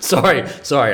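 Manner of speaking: apologizing through laughter; giggling sorry, sorry; laughing. Real, not acted. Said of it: "I was laughing at something else".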